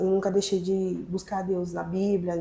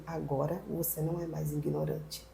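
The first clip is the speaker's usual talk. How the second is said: soft, hushed imitation of God; quiet retold pronouncement; retold divine voice; 3 semitones lower, 13 dB quieter than usual. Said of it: "eu ouço outra voz dizendo assim".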